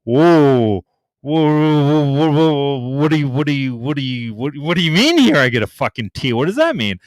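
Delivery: stammering mock confusion; retelling his own baffled reaction; confused disbelief. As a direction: sputter like you can't follow the rules. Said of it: "And I'm like".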